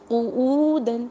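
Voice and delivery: قعودن with a rounded, stretched و; recited example. Shown as a demonstration. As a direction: recite it the way the Quran is read. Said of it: "جیسے".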